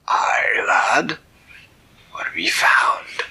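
loud pirate captain; gruff old sea captain. Acted. Captain answering the boy from the ship, loud pirate voice. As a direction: bellow it like an old sea dog.

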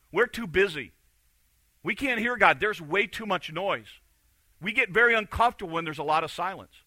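loud, driving sermon climax; forceful preaching. Acted. Preacher urging a busy congregation, loud and driving.

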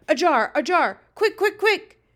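loud, high-pitched urgent shouts; urgent cry. A narrator's reading. Giant calls to his helper for a jar, urgent cry. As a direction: fire it out in a panic of haste. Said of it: "he cried".

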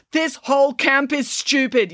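high, slow yelled complaint; angry yell. A narrator's reading. Brandon joins the team's argument, yelling. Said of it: "yelled Brandon".

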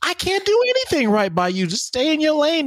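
put-on high, petulant teen whine; whiny little brother. David whining to his big brother, high and petulant.

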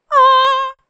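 high, drawn-out crowd aah. Shown as a demonstration. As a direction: sing out an admiring aah. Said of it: "si yo abro los brazos así, ustedes van a decir".

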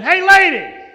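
loud, high rude shout; crowd yelling. Retold or imitated. Rude crowd member shouting at the woman. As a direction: bark at the woman pushing through. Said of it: "people in the crowd going".

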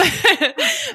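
a sudden high laugh; real laugh breaking out high; bright high laugh, real; high burst of laughter. Real, not acted. Host laughs at own joke.

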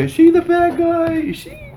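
very high-pitched questioning squeak; exaggerated falsetto wondering; mock puzzlement. Voices a puzzled viewer's question.

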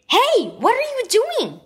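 sharp demanding question; high, demanding boy's voice. A narrator's reading. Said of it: "Tony demanded".

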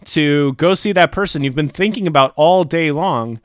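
ordinary talk, nothing marked

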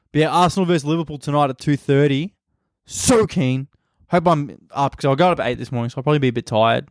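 ordinary talk

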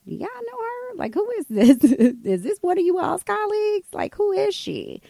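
high-pitched, laughing disbelief; bewildered. Retold or imitated. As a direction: replay a baffled aside to friends. Bewildered aside to friends.

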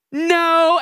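high, loud mock refusal; sharp rejection. Girl turning down a boy's proposal, loud and blunt. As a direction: fire it out high and hard. Crush flatly rejects him.

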